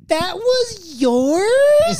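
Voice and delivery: high, slow, stretched disbelief; surprised server. Retold or imitated. Server realizing she took the cook's sandwich, high surprised drawl. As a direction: draw out the question in shock.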